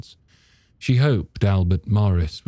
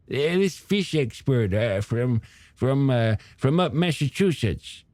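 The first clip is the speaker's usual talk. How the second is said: put-on old shopkeeper character; 6 semitones higher than usual. Storekeeper grumbling to a customer about the beaches, high character voice. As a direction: play a hesitant, opinionated storekeeper, pitched up.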